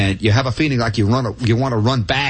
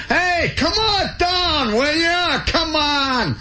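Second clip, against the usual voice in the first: high, slow, far-off hollered invitation; TV ad guy yelling; much slower than usual. Retold or imitated. TV resort ad guy calls viewers down to Florida, slow high yell. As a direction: bellow it like a resort ad pitchman. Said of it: "And he yells".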